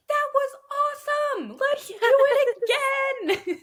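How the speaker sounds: put-on tiny excited kid, squealing way up high; high-pitched adorable voice. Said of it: "in his high-pitched, adorable voice".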